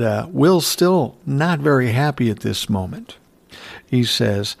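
ordinary talk, nothing marked

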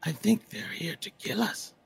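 high creepy voice talking to itself; paranoid, questioning dark creature; eerie creature voice. Acted. Creature talks to Toby and party.

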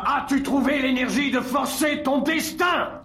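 very high roaring demand; furious shout. Acted. Caesar shouting at the man in the prison, furious shout. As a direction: bellow the challenge at him.

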